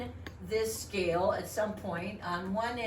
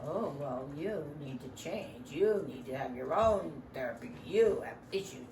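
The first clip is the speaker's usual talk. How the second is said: lower, lightly voiced know-it-all therapist; mock therapist; 6 semitones lower than usual. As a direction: talk down to the parent, a hint of smugness. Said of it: "The therapist's like".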